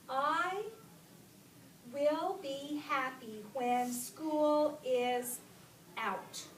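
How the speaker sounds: slow, loud, stretched-out reading; word-by-word reading. Shown as a demonstration. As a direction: read it out word by word, emphatically. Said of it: "Let me read it again".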